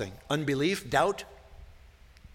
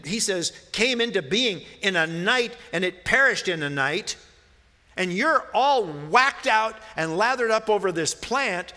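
raised, loud pulpit voice, pressing each question; preaching at full force; 6 semitones higher, 4 dB louder than usual. Acted. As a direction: preach it hard, driving the point home. Pastor preaching God's rebuke.

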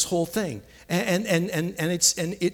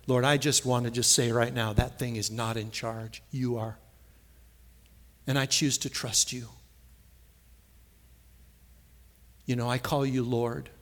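prayer; 4 semitones lower than usual. Acted. Preacher modelling a prayer of surrender to the Lord. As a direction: pray it humbly and earnestly.

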